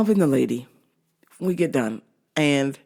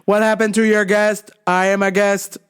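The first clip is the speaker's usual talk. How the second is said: mock deaf man's even, insistent voice; yelling deaf customer. Deaf customer claims guest access, imitated yelling. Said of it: "Just yelling, man".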